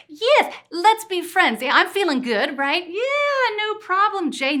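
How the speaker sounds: high, loud mock cheer; overdone sarcastic agreement. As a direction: fake brightness hiding hurt. Sarcastic acceptance of breakup.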